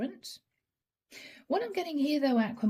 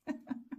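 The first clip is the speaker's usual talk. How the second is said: quiet, suppressed chuckle; real laugh; 10 dB quieter than usual. Real, not acted. Tarot reader amused by the Tower card, quiet real laughter. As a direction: laugh softly, trying to hold it in. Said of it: "I'm sorry I'm laughing".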